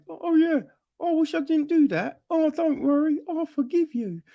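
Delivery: high, fast, sugary mock apology; mock sappy characters. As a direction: parody the too-easy making up, pitched high. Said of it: "it's just like".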